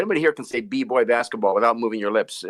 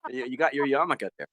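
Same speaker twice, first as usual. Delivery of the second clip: normal talking voice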